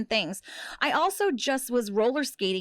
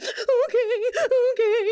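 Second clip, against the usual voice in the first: much higher, slow, weepy retold okays; imitating crying; 12 semitones higher, 4 dB louder, much slower than usual. Crying prisoner answering the tough inmate, high, slow and weepy. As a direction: whimper it out like you're sobbing in the van. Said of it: "and just crying in the background".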